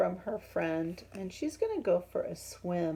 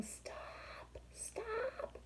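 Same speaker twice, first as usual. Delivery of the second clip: high, much softer, near-voiceless; whispered plea; 10 dB quieter, much slower than usual. Acted. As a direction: plead in a tiny whisper. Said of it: "Quincy is saying".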